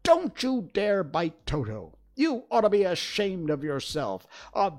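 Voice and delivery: high, loud scolding cry; furious girl defending her dog, loud and high; angry outcry. A narrator's reading. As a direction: scold the lion fiercely, voice up high. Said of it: "while she cried out".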